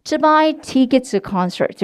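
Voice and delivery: drawn-out practice line; very slow model. Shown as a demonstration. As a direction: stretch each word slowly. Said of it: "시작".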